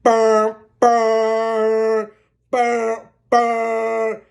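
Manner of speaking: imitated engine rumble; truck engine noise. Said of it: "remember the truck".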